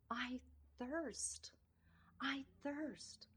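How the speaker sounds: breathless whisper, barely voiced; faint whisper. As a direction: whisper it like a dying man's plea. Jesus on the cross thirsting.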